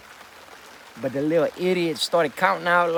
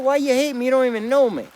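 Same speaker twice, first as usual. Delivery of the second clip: raised, higher, loud protest; heated protest; 9 semitones higher, 7 dB louder than usual. Retold or imitated. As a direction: demand it loudly, stung. Retold argument, heated protest.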